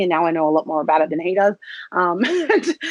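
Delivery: normal talking voice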